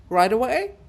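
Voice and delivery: high, loud rising ask; Vertinsky asks if it is urgent; eager question. Acted. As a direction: check eagerly.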